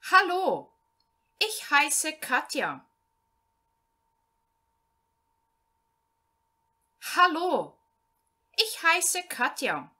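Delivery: slow, clear German read-aloud, each phrase set apart; slow learner reading. Shown as a demonstration. Teacher reading a German text to Portuguese-speaking beginners, slow and clear. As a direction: read it out slowly so beginners can follow.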